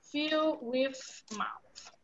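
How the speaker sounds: slow, drawn-out words while writing; slow dictation. Shown as a demonstration. Teacher dictating to the class as she writes, slow. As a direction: say it slowly as you write it down. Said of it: "so I'm going to write here".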